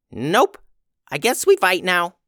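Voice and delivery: a small goblin shrugging into a fight; resigned little goblin. Acted.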